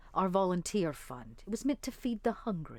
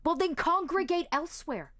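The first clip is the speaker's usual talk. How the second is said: higher, sneering brush-off; Bryce's voice; 9 semitones higher than usual. Acted. Bryce to the zealot, sneering higher. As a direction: toss it off higher and dismissive. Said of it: "he says".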